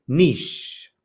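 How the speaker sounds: niche said as "neesh", long ee and a soft sh ending; correct niche. Shown as a demonstration. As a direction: end the spelling with niche as "neesh". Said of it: "N I C H E".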